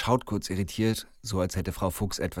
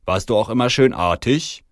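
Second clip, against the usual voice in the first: raised, loud Santa questioning; Santa's question; 4 semitones higher, 7 dB louder than usual. Acted. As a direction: quiz him as Santa would a child. Santa quizzes the store manager.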